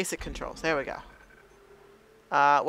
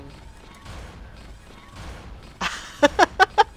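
laugh; 8 semitones higher than usual. Real, not acted. Amused while stuck in the game.

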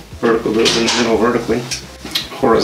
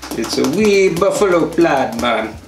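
playful Irish brogue sliding into Rastafari; high, slow mock Irish with a Rasta "man"; muddled Irish and Rastafari accent; 4 semitones higher, slower than usual. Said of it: "with an Irish accent or a Rastafari accent".

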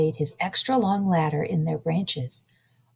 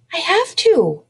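high, loud protest; crying out; 11 semitones higher, 7 dB louder than usual. A narrator's reading. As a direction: cry it out in protest. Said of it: "Emma cried".

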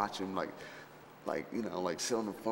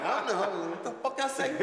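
higher, chuckling mock mumble; laughing confusion; 11 semitones higher than usual. Rapper lost in his own lyrics, laughing. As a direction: laugh at yourself for forgetting the words.

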